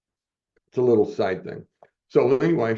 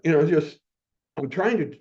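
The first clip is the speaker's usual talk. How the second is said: ordinary talk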